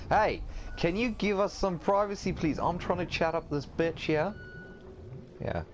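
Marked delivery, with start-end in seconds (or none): 0.1-4.3 higher-pitched mock-indignant complaint; put-upon demand for privacy, played up. Shoos away intruder in role play.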